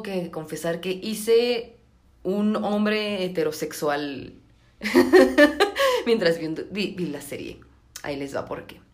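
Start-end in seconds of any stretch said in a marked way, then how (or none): 4.8-6.0 high loud laugh; burst of laughter. Real, not acted. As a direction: crack up at yourself. Risa tras confesión. (carcajada aguda y fuerte)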